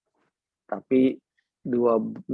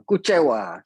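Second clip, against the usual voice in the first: mock disappointed; 5 dB louder than usual. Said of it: "saya bilang".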